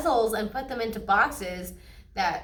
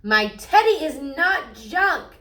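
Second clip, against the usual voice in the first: slow, louder, higher cry of protest; screaming; 5 semitones higher, 6 dB louder than usual. A narrator's reading. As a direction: scream it to save the teddy. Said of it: "screamed sister".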